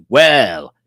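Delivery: hammed-up greeting, big swoop up on hello; catchphrase greeting. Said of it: "he says".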